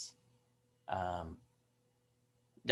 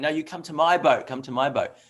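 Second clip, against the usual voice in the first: loud, high, pushy call across the water; eager boatman calling; 5 semitones higher, 8 dB louder than usual. Retold or imitated. Boatman calling to the pilgrim woman, loud and eager. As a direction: shout after her, keen for the fare. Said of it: "very enthusiastically wanting her business".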